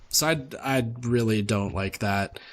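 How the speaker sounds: normal talking voice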